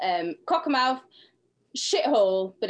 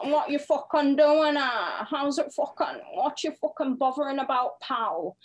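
mock Carlisle local, pitched up; Carlisle accent; 4 semitones higher than usual. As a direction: imitate a confrontational Carlisle accent. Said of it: "That's how they sound in Carlisle".

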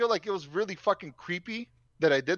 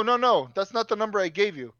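normal talking voice

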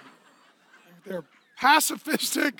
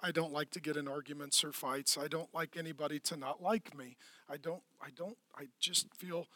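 very soft, low, meek voice; hammed-up nervous meekness; timid lamb person; 7 semitones lower, 14 dB quieter than usual. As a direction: shrink back quietly, avoiding any conflict. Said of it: "What happens if you're only a lamb?".

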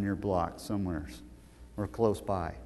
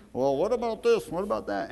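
imitating the inmate; 8 semitones higher than usual. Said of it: "one guy kept raising his hand".

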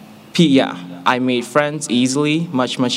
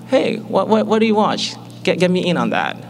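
higher-pitched, eager younger self; eager asking; 8 semitones higher than usual. Retold or imitated. New student asking school friends for shows to watch, eager. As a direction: ask keenly, pitched well up.

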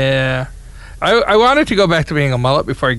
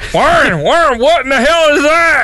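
barking drill sergeant yell, raised pitch; 8 semitones higher than usual. Retold or imitated. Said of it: "drill sergeant would be like".

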